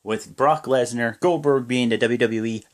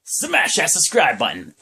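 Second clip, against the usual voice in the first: big outro shout; 5 semitones higher than usual. Real, not acted. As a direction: sell the subscribe plea like a hype man. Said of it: "I'm gonna hurt myself doing this".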